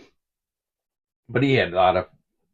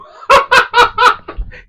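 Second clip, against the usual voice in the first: high laugh; 11 dB louder than usual. Real, not acted. Laughing at the kid's line.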